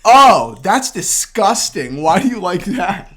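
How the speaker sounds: hammed-up disgusted woman; mock disgusted client. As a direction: play the client recoiling at the dish. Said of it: "And then she goes".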